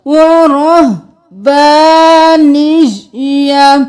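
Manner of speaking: slow recitation. Shown as a demonstration. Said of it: "نون زیر یا".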